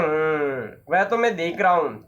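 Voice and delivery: a grave, thoughtful doctor. Acted. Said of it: "doctor सहसा गंभीर होकर". (गंभीर स्वर में डॉक्टर)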